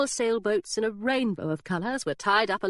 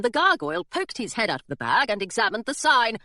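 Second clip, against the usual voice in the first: ordinary delivery, nothing marked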